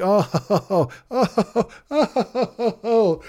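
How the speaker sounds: high, giggling oh oh oh; imitating a delighted chuckle at how quaint; teasingly tickled, laughing through each oh; mock laughing coo. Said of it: "And I'm like".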